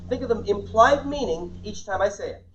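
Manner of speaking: ordinary delivery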